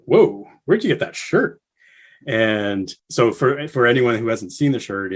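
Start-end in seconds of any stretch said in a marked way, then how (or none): 0.0-1.6 imitated surprise, pitched higher; higher amazed question; surprised whoa. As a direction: lightly act out Dean's amazement at the shirt. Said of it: "he's like".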